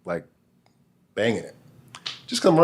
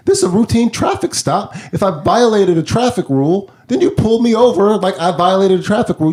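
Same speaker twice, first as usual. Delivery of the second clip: heated arguing; 9 semitones higher, 4 dB louder than usual. Retold or imitated. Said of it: "not being very nice".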